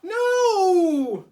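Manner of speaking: very high, very loud No; shouted no. Acted. Toad refusing to chase the list, shouting. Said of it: "shouted Toad".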